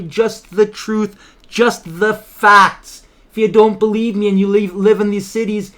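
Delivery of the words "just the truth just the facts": each word weighted and slow; slow emphatic. Real, not acted. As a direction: lay it down word by word, slowly.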